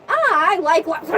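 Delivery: high, loud mock yelp on I like what; cut-off yelp. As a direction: yell it out as he gets squished. Polites squished mid-sentence.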